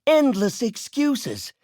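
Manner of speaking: high, mocking stammer; mocking scorn. Boss mocking his research assistant's excuses, high scornful mimicry.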